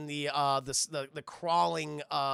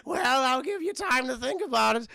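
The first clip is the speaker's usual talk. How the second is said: loud, high, gloating king voice; Bumi; 5 semitones higher, 6 dB louder, slower than usual. Retold or imitated. The king gives Aang little time, loud theatrical taunt. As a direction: taunt slowly in a put-on voice. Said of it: "He's like".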